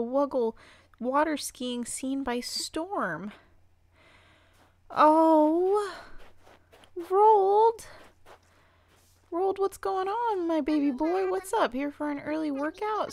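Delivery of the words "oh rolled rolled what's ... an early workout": high, loud excited coo. Real, not acted. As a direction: coo his name with delight. Greeting adored villager.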